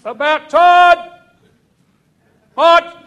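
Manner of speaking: high, very loud yell. Retold or imitated. Sergeant yells at departing recruits, very loud. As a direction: yell to stop them in their tracks. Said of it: "he yelled out".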